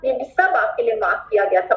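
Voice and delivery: ordinary talk, nothing marked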